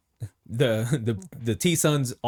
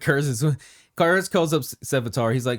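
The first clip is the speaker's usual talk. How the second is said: ordinary talk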